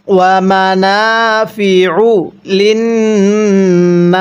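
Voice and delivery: "লিন্নাস" very slow, ending on s with no final vowel; pausal stop. Shown as a demonstration. Teacher shows how to pause at the end. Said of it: "এখন ওয়াকফ করলে কিভাবে পড়ব?".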